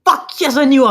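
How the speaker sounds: high excited yelp; thrilled cheer. Acted. Anthony celebrating on the bed, excited yelp. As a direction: punch the air with it.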